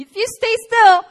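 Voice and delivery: high, loud urgent voice calling out. Retold or imitated. An inner voice telling her in the river, high urgent call. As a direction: call the instruction out from above. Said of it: "that voice came to me".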